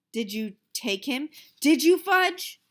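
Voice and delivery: rising to a loud, high, slow demand; big brother's calm cracking into a shout; not so calmly. Acted. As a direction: lose patience with the little brother. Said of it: "not so calmly".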